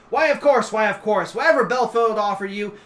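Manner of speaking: high, loud, effusive dragon ruler; King Sideshow. Acted. Dragon king grants petitioner's request, high effusive assurance. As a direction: gush it, repeating eagerly. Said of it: "And he goes".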